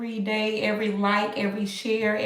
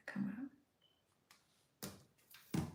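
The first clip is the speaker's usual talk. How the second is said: ordinary talk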